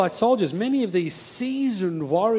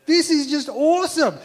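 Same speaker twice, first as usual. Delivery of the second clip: put-on thrilled young hopeful; 5 semitones higher than usual. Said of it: "instead of feeling discouraged".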